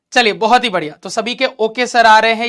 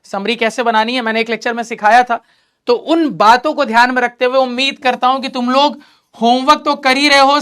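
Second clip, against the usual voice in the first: normal talking voice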